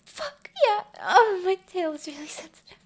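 high, loud cry of pleasure; ecstatic cry. Acted. Creature lover crying out as her tail is grabbed, loud high cry.

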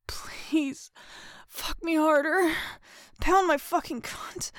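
high, loud, urgent begging; desperate pleading. A narrator's reading. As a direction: plead with rising force. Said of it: "I pleaded desperately".

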